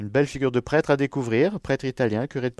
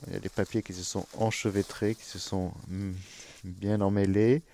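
usual speaking voice

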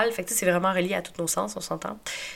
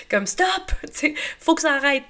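angry; 7 semitones higher, 3 dB louder than usual. Retold or imitated. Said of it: "je faisais juste comme".

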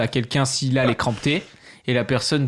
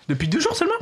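incredulous; faster than usual. Real, not acted. Teasing disbelief at guest.

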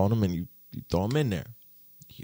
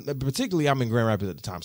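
ordinary talk

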